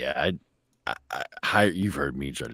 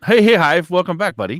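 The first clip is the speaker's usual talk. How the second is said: much louder, higher hype greeting; excited shout; 12 dB louder than usual. Real, not acted. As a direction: call out a big welcome back. Greeting returning streamer.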